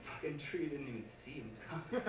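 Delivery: quick muttered aside under laughter; half-laughing throwaway repeat of the punchline; quiet fast repeat. Shown as a demonstration.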